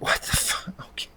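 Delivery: whispered disbelief, barely any voice; baffled whisper. Acted. Reviewer reacting to the mom's odd remarks, baffled whisper. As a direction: whisper it under your breath, stunned. Stunned aside at the dialogue.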